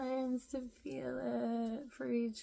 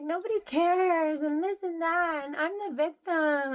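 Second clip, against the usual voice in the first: complaining beggar; 5 semitones higher, 9 dB louder than usual. Retold or imitated.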